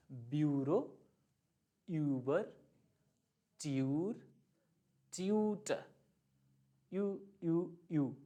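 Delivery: ब्यूरो, यूबर, ट्यूर, ट्यूटर, then यू as a fast ee-oo; rounded glide on every यू; U umlaut drill. Shown as a demonstration. Teacher repeating examples to shape the mouth, deliberate glide. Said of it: "दोबारा बोलते हैं".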